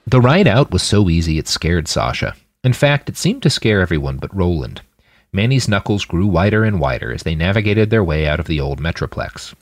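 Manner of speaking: ordinary delivery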